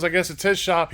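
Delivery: normal talking voice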